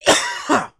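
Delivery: harsh choking cough; coughing up water; coughing fit. Real, not acted. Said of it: "drink went down the wrong pipe".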